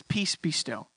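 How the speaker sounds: low, soft, quiet command; calm rebuke. Retold or imitated. Jesus rebuking the storm, low and softly. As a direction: still the storm with quiet authority. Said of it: "he rebukes".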